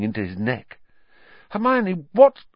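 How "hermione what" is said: high, alarmed cry; shocked. A narrator's reading. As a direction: sound startled and lost. Harry shocked after time travel.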